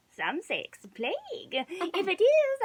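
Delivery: hint the plague with menace; sly hag. A narrator's reading.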